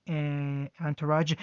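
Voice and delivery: ordinary delivery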